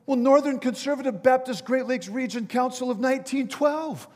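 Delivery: high, loud recital of a long title; calling out. Acted. Jumper giving final denomination to bystander, calling out.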